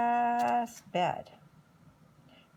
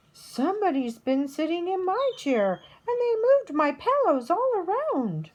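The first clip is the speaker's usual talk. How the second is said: higher, louder indignant mama bear; 8 semitones higher, 6 dB louder than usual. A narrator's reading. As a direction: climb up in pitch, outraged mother. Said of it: "Mama Bear comes over and says".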